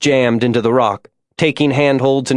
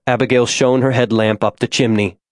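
ordinary delivery, nothing marked

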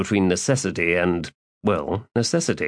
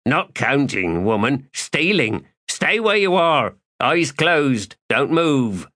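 commanding villain; 6 semitones higher than usual. Acted. Thief ordering her.

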